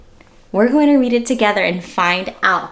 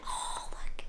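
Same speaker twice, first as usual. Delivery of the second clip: hushed whisper; 18 dB quieter than usual. Real, not acted. Teacher pointing out the bear, whispered.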